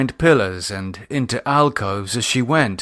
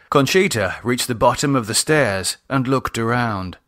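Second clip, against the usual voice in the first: usual speaking voice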